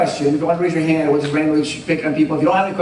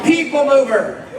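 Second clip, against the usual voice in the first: high, slow playful shout; mock announcer call; 9 semitones higher, slower than usual. Answering the rival sales pitch, playful high shout.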